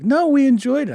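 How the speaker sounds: very high mock princess voice; high-pitched retold reassurance; royal daughters.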